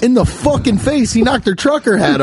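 high, worked-up exclamation; emphatic disbelief. Real, not acted. Cohost confirms where she was hit, worked up.

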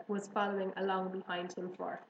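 ordinary delivery

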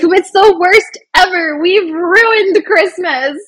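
hammed-up dismay, dragging out the words; mock despair. Acting out her dismay over Christmas.

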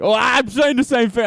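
high, loud mock-British protest; exaggerated British impersonation; imitated British accent. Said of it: "kept doing an accent of him".

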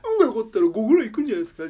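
fast, high mocking echo; mocking mimicry. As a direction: parrot it back snidely. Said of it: "じゃないんだよ".

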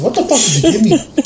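half laughing at himself; laughing retold demand. Confronting the girl, laughing. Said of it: "I said".

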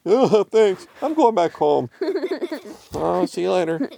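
high cartoon cat voice; squeaky, cheerful farewell; Randy departing. A narrator's reading. Randy says goodbye and leaves.

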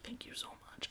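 breathy whisper; whispered thanks. Retold or imitated. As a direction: whisper it gratefully. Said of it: "And he was like".

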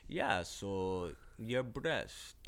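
slow, low, mock British talk-show host; UK accent. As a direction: play a creepy British interviewer, drawn out. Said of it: "your, your U K voice".

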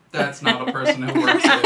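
high, laughing as she says it; laughing retort. Retold or imitated. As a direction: tell them through a laugh. Retold phone call, laughing reply.

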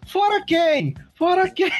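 high-pitched imitation of a son shouting; child's mock yell. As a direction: yell it like a kid joining in. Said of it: "Papai, acho que eu vou gritar".

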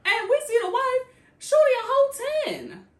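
loud gushing over how stunning the wife is; excited disbelief. Real, not acted. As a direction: hype her up, amazed. Excited reveal of the wife's looks.

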